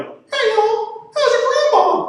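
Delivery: very high, loud cartoon Southern voice; talking sweet tea. Sweet tea greeting whoever takes a sip, high cartoon voice. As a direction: squeal a hammed-up Southern hello. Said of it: "and that tea's like".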